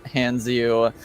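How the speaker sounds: ordinary delivery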